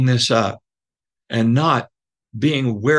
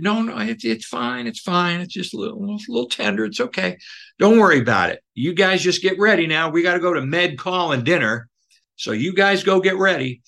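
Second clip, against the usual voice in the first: gentle reassurance; 7 semitones higher than usual. Retold or imitated. Counselor reassures boys about knee.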